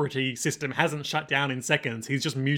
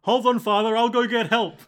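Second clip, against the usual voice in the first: nervous guard; 7 semitones higher, 5 dB louder than usual. Acted. Voicing frightened guard.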